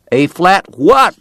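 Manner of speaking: a cowboy hollering back confused; puzzled shout across the room. Acted. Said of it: "the cowboy shouted back".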